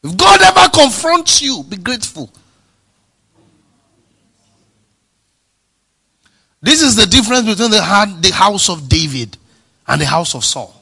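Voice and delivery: high, loud preacher's climax; full-force preaching. Acted. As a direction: drive it home to the congregation.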